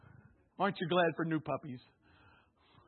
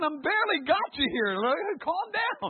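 flustered pleading; 10 semitones higher, 8 dB louder than usual. Retold or imitated. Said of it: "And I'm thinking".

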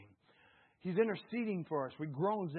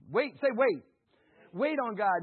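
ordinary delivery

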